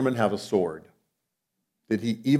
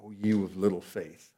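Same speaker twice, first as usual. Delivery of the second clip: much softer, fond gentle rebuke; tender; 5 semitones lower, 9 dB quieter than usual. Retold or imitated. Jesus to frightened Peter, a soft fond rebuke. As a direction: say it with a parent's tenderness. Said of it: "the heart of a parent".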